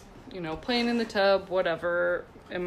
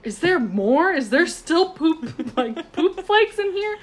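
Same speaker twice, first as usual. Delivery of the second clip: put-on kids wondering; 9 semitones higher, 6 dB louder, slower than usual. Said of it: "they're like".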